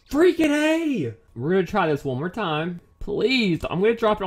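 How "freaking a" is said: frustrated outburst. Real, not acted. Game keeps crashing.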